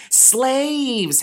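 high bitter gnome cry; a hint of a wounded gnome. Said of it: "Hap said".

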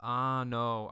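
ordinary talk, nothing marked

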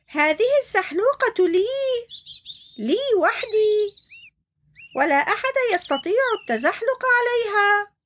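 high, mock-shouting hippo; possessive shout. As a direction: claim the slide like a spoiled child. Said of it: "ووقف عليها وصرخ". (صياح متملك بصوت عالٍ)

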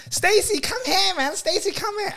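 high call across the room. Retold or imitated. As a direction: call your friend over, high. Calling out to friend.

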